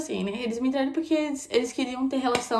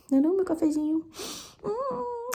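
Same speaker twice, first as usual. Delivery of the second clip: cooing to puppy; 8 semitones higher than usual. Real, not acted. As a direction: coo a question at the dog.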